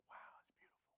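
awed unvoiced whisper; whispered wow. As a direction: breathe it in wonder. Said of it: "It's like".

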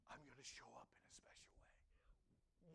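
soft and mysterious; God's whisper. Acted. Preacher voicing God's hushed promise to the gathered church. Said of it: "But God says".

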